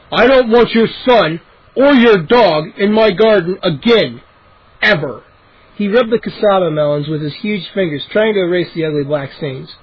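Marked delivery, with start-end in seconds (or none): 0.1-5.2 stern. Acted. Angry neighbor bans dog.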